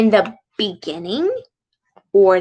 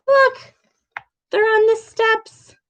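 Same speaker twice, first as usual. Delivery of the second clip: excited; 12 semitones higher than usual. Real, not acted. Teacher excited over picture book.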